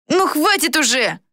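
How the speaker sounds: put-on high-pitched fed-up boy, mildly done; exasperated outburst. A narrator's reading. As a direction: lose patience in a high voice. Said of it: "не выдержал Флорин".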